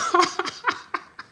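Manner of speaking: breathy high-pitched laugh bursting out; high airy laugh. Real, not acted. Cracking up at a viewer's comment, high and airy.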